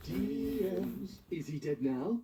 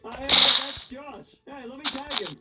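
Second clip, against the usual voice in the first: loud proud announcement; excited shout; 11 semitones higher, 8 dB louder than usual. Acted. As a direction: yell it out proudly. Excited shouting own name.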